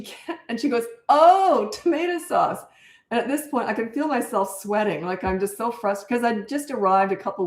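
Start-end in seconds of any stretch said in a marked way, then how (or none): 1.1-2.6 high "Oh, tomato sauce" of dawning understanding; the girl finally getting it; pitched-up relief; mock realization.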